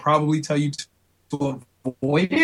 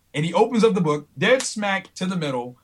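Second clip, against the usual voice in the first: ordinary delivery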